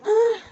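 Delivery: sudden high yelp; startled shout. Real, not acted. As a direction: cry out in surprise. Sudden loud cry.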